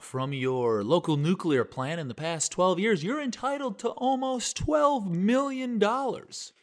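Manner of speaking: slow high-pitched lawyer ad voice; legal ad announcer. Retold or imitated. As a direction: read it like a cheesy TV ad. Parodying legal TV ad.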